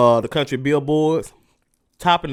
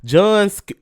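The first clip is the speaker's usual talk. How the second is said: ordinary talk, nothing marked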